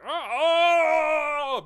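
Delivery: mock agonized oh, very high and loud; high cry of the quickening. Retelling the quickening, a high loud wail. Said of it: "He's like".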